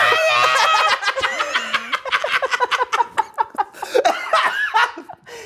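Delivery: high, helpless cackling; shrieking laughter that keeps going; long laughing fit. Real, not acted. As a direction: crack up uncontrollably. Cracking up at the imitation.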